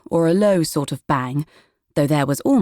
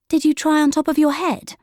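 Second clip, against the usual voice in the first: put-on high, bright young girl's question; a child pointing out the obvious; helpful girl; 11 semitones higher than usual. A narrator's reading. Willow asking Prudence about her glasses, high girlish voice.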